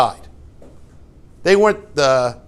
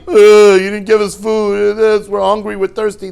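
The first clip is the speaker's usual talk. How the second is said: mock kvetching; 4 semitones higher, 6 dB louder than usual. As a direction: imitate a crowd griping about food, starting loud. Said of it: "Always scratching".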